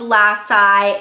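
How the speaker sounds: Lassie said "LASS-eye"; mispronounced Lassie. Shown as a demonstration. Host showing viewers how not to say it, Lassie as "LASS-eye". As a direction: end Lassie in an "eye" sound. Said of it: "it's not pronounced Lassie".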